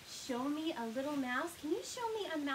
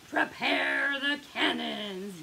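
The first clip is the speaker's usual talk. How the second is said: slow, stretched battle call; half-yell it like a little pirate crew; mild pirate yell; 5 dB louder, much slower than usual. A narrator's reading. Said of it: "yell her little pirates".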